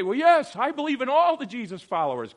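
high, loud, overdone agreement; hammed-up enthusiastic claim; mock eager believer. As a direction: gush it like an eager church member. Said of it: "You say".

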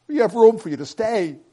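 loud appeal into the distance; calling out. A narrator's reading. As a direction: call out an urgent invitation. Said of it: "Bill called again".

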